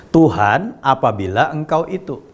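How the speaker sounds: low, hesitant reading of a plea; doubtful. Acted. Peter questioning whether it is really the Lord, low doubtful voice. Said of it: "pertanyaan Petrus ini mengandung keraguan".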